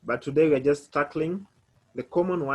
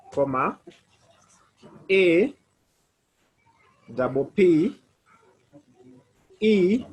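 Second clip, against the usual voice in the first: letters called out louder, paused; repeating the spelling louder; 3 dB louder, slower than usual. Shown as a demonstration. Teacher repeating the spelling for a confused student. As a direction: spell it again, clearer and louder. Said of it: "Let me repeat".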